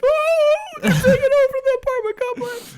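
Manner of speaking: very high, loud shrill panic; panicked high mock voice. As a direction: squeal it like a scared neighbour. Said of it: "everybody's like".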